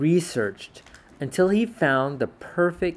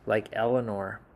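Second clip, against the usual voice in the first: plain reading voice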